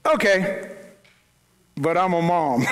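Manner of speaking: lightly hammed-up stubborn mom; mock defiant mom. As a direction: toss it off like a mom who won't take no. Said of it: "you just go".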